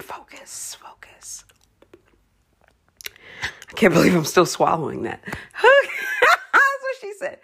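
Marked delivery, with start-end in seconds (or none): 0.0-1.4 very soft whispered focus; hushed self-reminder. Real, not acted. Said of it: "self-instruction". 5.2-7.4 high, slow laughing that's what she said; giggly wisecrack; laughing punchline. Real, not acted.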